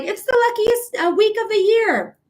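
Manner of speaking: high, bright sing-song mockery of upbeat astrologers; mocking others. Mocking the people who called it lucky, pitched high and sing-song. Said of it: "they're like".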